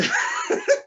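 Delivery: high burst of laughter; real laugh. Real, not acted. Telling the train story, bursts out laughing. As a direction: break up laughing.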